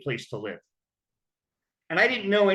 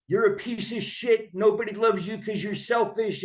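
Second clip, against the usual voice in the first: mock scolding scream; 6 semitones higher than usual. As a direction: imitate a man berating someone, voice pitched up. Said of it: "I don't hear him screaming".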